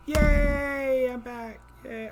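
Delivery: high, loud happy whoop; excited cheer. Real, not acted. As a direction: celebrate being back online. Back online, cheering.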